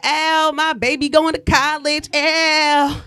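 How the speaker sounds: put-on proud parent bragging about a college kid; proud Facebook parent. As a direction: brag about your kid going to college. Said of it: "They like".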